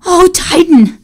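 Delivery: thrilled exclamation; joyous cry. Acted. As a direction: burst out in delight. Joyful exclamation after discovery.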